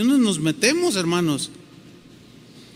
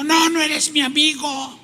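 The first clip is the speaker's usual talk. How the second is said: betrayed rejection; 8 semitones higher than usual. Acted. Betrayed man rejecting his friend, wounded and protesting. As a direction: act out refusing a friend's explanation. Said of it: "de sentirse traicionado".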